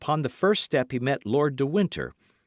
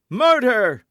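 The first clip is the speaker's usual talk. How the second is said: high, very loud alarm cry; scream; 14 semitones higher, 11 dB louder than usual. A narrator's reading. Patrick sees the Duke stabbed, screaming alarm. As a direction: scream it to raise the house. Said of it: "screamed Patrick".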